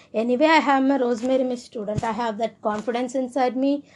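ordinary talk, nothing marked

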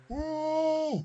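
high, quiet, stifled "mmm"; muffled scream for help. Shown as a demonstration. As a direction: try to scream through a covered mouth. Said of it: "this is how it sounded. I was trying to scream out for help".

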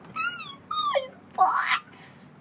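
hammed-up sobbing whine, very high and slow; mock crying. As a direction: wail it like a crybaby buyer. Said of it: "Don't start crying".

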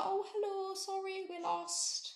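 high, soft, sweet lost-tourist voice; polite little voice of a lost tourist. Retold or imitated. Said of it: "doing my best little tourist voice".

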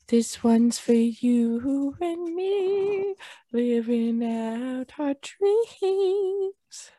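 slow drawn-out dreamy toast. Acted. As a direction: savour each word like a ritual.

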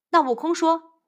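ordinary delivery, nothing marked

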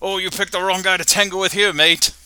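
put-on Australian caller threatening a punk kid; threatening Aussie. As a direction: sputter the threat like a furious Aussie. Bruno threatens Bart.

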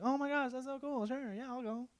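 high, light put-on gush; mock eager gushing. Invitee accepting culture night as hoped, mock eager gushing. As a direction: gush sweetly in a high, small voice.

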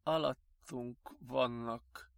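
soft, slow muttering to oneself; sedated mumble. A narrator's reading. Sedated patient muttering to himself, drowsy mumble. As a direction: mumble it while drifting off. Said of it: "csupán motyogott maga elé".

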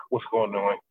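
ordinary talk, nothing marked

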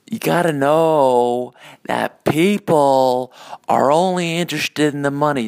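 imitating someone's emphatic pronouncement; quoted guy's voice. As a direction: proclaim it like a famous catchphrase, stretched out. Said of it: "that quote by that guy".

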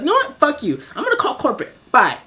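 high, indignant mock send-off; angry customer. Customer threatening before hanging up, high-pitched angry dismissal. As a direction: cut him off like an outraged caller.

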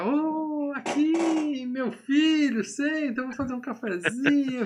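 very high, sugary welcome; fawning father-in-law. Retold or imitated. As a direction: gush like a suddenly friendly father-in-law. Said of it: "o sogrão já".

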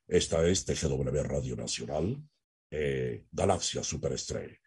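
mock radio announcer forcing a low voice; locutor de voz forzada. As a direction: push the voice down low and soft like a bad announcer. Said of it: "Forzan su voz". (imitación de locutor con la voz forzada hacia lo grave)

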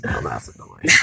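very low, slow whispery Aussie; Australian accent. Pretend. Repeating the gag for laughs, deep whispery Australian accent. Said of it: "talk in an Australian accent".

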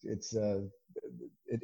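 ordinary talk

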